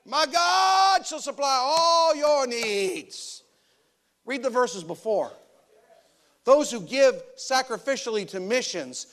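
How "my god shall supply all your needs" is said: slow, drawn-out, pleading mock prayer voice; mocking pious quote. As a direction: ham up a wailing believer claiming the verse. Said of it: "we quote Philippians four".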